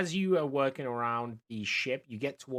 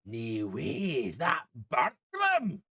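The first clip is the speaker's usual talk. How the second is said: slow, rising call of surprise; a stranger calling out in disbelief; 2 semitones higher, 5 dB louder, much slower than usual. Acted. NPC calls out on the docks.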